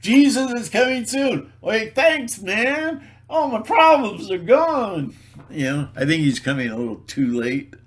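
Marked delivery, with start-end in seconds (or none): 0.0-5.2 high, loud mock relief; mocking believers, high sing-song relief; mock grateful believer. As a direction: ham up the thankful convert. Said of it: "That's gonna solve everything".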